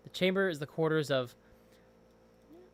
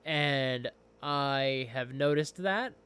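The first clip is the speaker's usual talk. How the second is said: usual speaking voice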